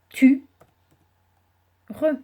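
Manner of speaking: Tu then re, split apart; sounding out words. Shown as a demonstration. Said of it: "syllabe par syllabe".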